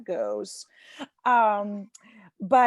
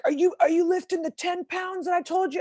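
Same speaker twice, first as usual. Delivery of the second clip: pushy trainer; 8 semitones higher than usual. Retold or imitated. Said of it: "He's like".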